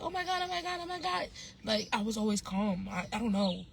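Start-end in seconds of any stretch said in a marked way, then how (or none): 0.0-1.3 high, frantic oh my God on repeat; hammed-up hysteria of a chaotic kid; mock panic. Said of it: "I was never really chaotic".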